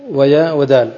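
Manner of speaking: usual speaking voice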